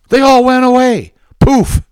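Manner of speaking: high, gloating "Poof"; mocking triumph. Host scoffing at the accusers, high mocking glee. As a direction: toss it off with a sneer.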